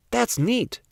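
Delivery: a quiet little wow of a line; hint of an amazed boy; Chester impressed. A narrator's reading. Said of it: "said Chester".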